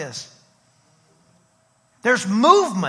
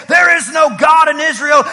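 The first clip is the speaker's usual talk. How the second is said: loud, raised declaration; screaming taunt; 5 semitones higher, 7 dB louder than usual. Acted. Goliath heard in the distance by David, loud taunting shout. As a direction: shout it out for a whole army to hear. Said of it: "Who is that screaming down there?".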